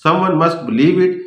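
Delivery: ordinary talk, nothing marked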